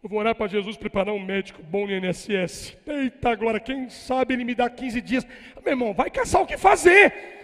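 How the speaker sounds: loud, high fiery sermon; full-force preaching. Real, not acted. Pastor urging believers to fight, preaching loud. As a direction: drive it home to the congregation.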